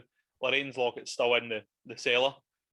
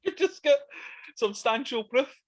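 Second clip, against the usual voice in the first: mock officious voice; 12 semitones higher, 3 dB louder than usual. Mocking the church's demands.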